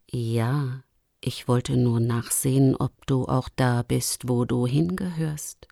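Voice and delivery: low, soft lynx voice; calm Luchsin. Acted. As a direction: speak low and quietly, as the older Luchsin. (ruhige, tiefe, leise Luchsstimme)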